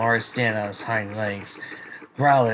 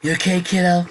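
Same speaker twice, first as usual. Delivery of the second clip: higher, louder character voice; rescuer checking in; 8 semitones higher, 6 dB louder than usual. Acted. Tall girl checking on the child she saved, raised voice. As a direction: call it out as a concerned rescuer.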